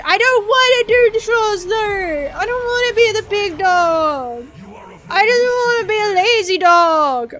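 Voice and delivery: hammed-up weepy whine; fake crying. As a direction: blubber like a kid refusing chores. Whining about adult chores.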